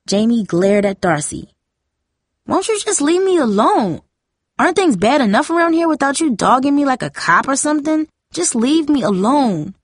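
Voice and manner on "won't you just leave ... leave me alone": put-on high-pitched exasperated teen outburst; angry outburst. A narrator's reading. Jamie glares at Darcy for dogging her, angry outburst. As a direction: lash out in a high, aggrieved voice.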